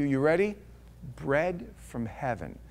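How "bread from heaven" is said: hushed reveal. Retold or imitated. God's promise, hushed reveal.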